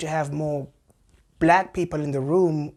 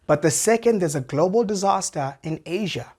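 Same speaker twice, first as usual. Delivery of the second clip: ordinary talk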